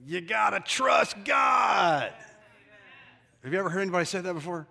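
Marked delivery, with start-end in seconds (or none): 0.0-2.1 loud, drawn-out raspy preacher shout. Retold or imitated. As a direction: imitate a preacher rasping it out at full force. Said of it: "I heard a preacher say that".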